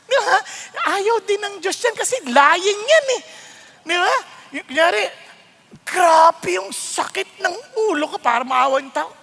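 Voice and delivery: ordinary talk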